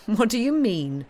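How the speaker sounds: lightly acted puzzled question; puzzled colonel. A narrator's reading. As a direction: voice a colonel querying his brother. Colonel questions his brother.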